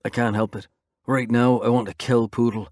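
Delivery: plain reading voice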